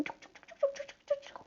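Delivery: high, soft chuk chuk chuk of feet; stepping sound effect. Acted. Teacher voicing Peter's careful steps, high soft clicks. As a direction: tiptoe the steps out in little sounds.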